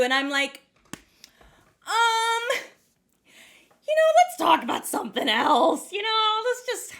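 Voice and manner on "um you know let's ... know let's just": high, stalling, hammed-up squirming; evasive embarrassment. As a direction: dodge the question with comic awkwardness. Dodging question about her own job.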